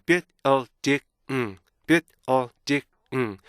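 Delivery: BUILDING chopped into bi-l-di-ng, each beat separate; "building" in four detached beats, ending on a hummed ng; four micro-syllables. Shown as a demonstration. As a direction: punch out "building" piece by piece with stops between. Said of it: "스탑 사운드를 잘 적용하셔가지고요".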